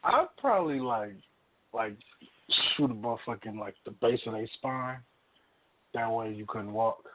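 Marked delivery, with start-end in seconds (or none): none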